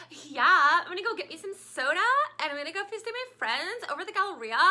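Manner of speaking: high, fast Valley Girl accent imitating the Dallas kids. Mom imitating how the Dallas kids sound, in a high, quick Valley Girl accent. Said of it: "that's how the kids sound around here".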